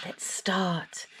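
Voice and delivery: hushed, breathy start; whisper. Real, not acted. Reader whispering to her listeners, hushed. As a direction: lean in and whisper it.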